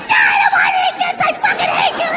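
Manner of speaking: very high-pitched screeched tantrum; hammed-up bawling little-boy fury; tantruming child. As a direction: screech at dad like a hysterical kid. Acts himself as furious crying child.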